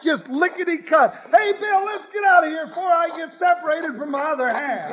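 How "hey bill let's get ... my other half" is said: flee in comic panic; panicky yell. Acted. Fleeing the saw trick.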